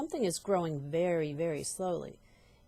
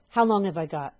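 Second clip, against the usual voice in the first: louder, pleading fear; frightened cry; 10 dB louder than usual. Acted. Wife begging husband, frightened and loud. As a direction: beg for an answer. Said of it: "cried Missus Twit".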